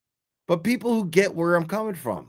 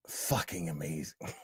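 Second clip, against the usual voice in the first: whisper; 11 semitones lower, 14 dB quieter than usual. Retold or imitated. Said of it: "he's like".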